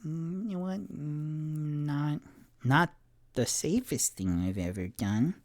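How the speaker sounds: put-on sheepish child. Child admitting to mother.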